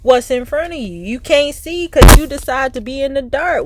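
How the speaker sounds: scolding. Acted. Scolding the querent.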